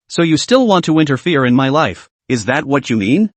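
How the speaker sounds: plain reading voice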